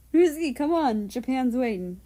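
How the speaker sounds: loud, high call to hurry up. Acted. Calling husband to hurry.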